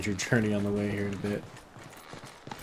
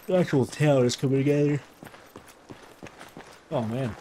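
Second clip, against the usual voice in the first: words stretched through a yawn; 5 semitones higher, 5 dB louder than usual. Real, not acted. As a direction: talk while yawning. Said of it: "I'm yawning".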